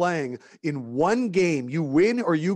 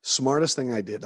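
ordinary talk, nothing marked

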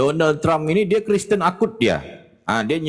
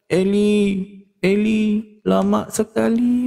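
higher, slow mock wail; drawn-out mock lament; 7 semitones higher, slower than usual. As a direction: moan it like a hammed-up crying Jesus. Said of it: "Nangis dia".

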